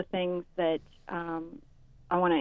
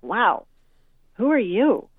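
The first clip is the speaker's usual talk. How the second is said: stunned; 3 semitones higher, 5 dB louder, slower than usual. Retold or imitated. Mom stunned by son's rudeness.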